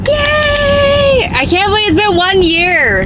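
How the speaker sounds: excited cheer. Real, not acted. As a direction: cheer it out with real delight. Celebrating one year in Houston.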